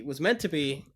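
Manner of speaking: ordinary talk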